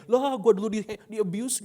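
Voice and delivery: high, loud comic complaint; mock protest. Grown child grumbles about the grandparents' old strictness, mock outrage.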